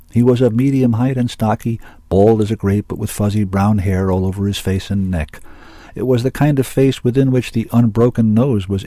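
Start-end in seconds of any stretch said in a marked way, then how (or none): none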